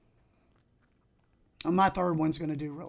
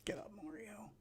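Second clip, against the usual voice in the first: very soft whisper to a game character; under the breath; 3 semitones higher, 19 dB quieter than usual. Real, not acted. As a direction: whisper it while absorbed in play.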